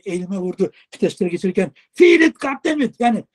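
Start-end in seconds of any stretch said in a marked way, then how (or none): 1.9-3.0 high, loud, slow mock bark; imitated yelling. Old trainer yelling during gear shifts, loud mock shout. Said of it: "Bağırdı".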